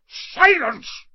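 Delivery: high loud bellow; yelling. A narrator's reading. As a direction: bellow for silence. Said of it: "yelled Uncle Vernon".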